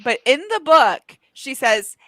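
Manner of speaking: ordinary talk, nothing marked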